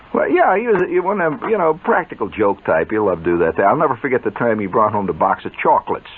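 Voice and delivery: usual speaking voice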